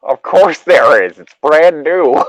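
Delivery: slow, loud, high indignant protest; indignant insistence. Acted. Sam insists his brand new lighter has fuel, slow and loud. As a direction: sound wounded, a know-it-all caught out.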